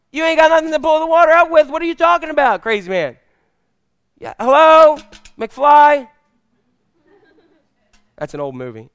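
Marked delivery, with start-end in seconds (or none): 0.1-3.2 high, incredulous scolding; mock exasperation. Woman scoffs at Jesus about bucket. 4.2-6.1 loud, high-pitched taunting holler; mocking wake-up call. Movie taunt at someone not listening.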